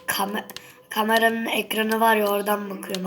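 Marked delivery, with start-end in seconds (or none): none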